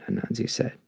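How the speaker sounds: expressive storybook narration with voiced animals; story reading. A narrator's reading. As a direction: read to children, giving each animal a voice.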